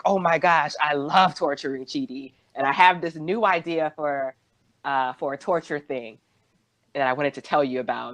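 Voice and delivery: ordinary talk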